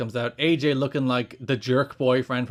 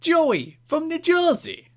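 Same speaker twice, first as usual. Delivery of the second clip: put-on New Jersey accent; slower than usual. Co-host demands the fan letter in a New Jersey accent. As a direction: ham up a New Jersey fan's voice. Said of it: "read this in a New Jersey accent".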